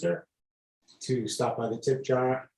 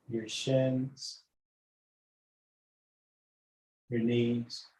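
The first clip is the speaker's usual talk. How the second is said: guided meditation. Acted. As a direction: lull them gently from toes upward. Leads body scan meditation.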